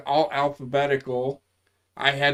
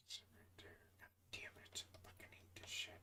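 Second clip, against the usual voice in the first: unvoiced muttering to himself; whispered mutter; 32 dB quieter, faster than usual. Real, not acted. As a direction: swear under your breath at the screen. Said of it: "whispers to self while searching".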